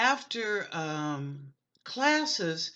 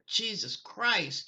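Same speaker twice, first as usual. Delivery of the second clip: normal talking voice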